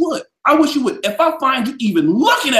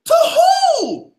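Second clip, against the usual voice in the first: "To who?" pushed still higher, near a squeal; even more outraged; 4 dB louder than usual. Real, not acted. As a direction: top the first one, incredulous.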